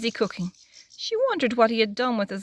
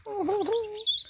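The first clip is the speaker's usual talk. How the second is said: high laugh; 12 semitones higher than usual. Real, not acted. Laughs at crying Peter.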